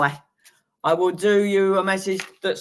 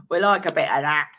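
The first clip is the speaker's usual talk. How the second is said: ordinary talk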